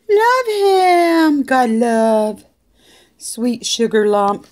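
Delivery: high, loud adoring coo; doting pet-owner gush, starting very high and easing down. Real, not acted. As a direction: squeal with love at your dog. Adoring outburst over the dog.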